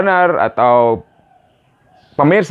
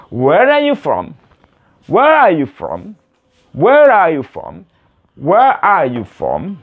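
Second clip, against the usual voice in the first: slow, stretched question, pitched up; model for repetition; 4 semitones higher, slower than usual. Shown as a demonstration. Teacher introduces the question to beginners, slowly and carefully. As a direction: space the words out evenly.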